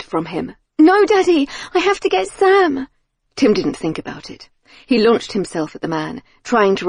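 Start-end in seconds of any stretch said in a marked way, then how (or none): 0.8-2.9 high, loud pleading cry; desperate shout. Acted. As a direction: cry out to her father. Daughter refuses father near fire.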